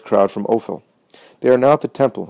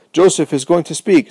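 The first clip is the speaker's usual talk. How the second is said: higher, eager announcement; excited shout; 7 semitones higher, 4 dB louder than usual. A narrator's reading. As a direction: crowd hushing the crowd. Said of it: "shouts of joy".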